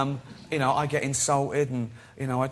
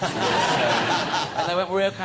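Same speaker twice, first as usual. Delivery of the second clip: louder, laughing; 4 semitones higher, 5 dB louder, much slower than usual. Real, not acted. Telling the couple's reply while laughing. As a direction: get the words out through laughter.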